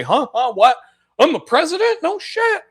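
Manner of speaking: high, dazed mock Biden voice; high mock-confused old man. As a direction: play a befuddled old president waking up.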